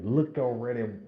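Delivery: ordinary talk, nothing marked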